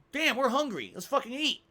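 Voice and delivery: hungry and eager to eat; excited retelling. Retold or imitated. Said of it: "we're like".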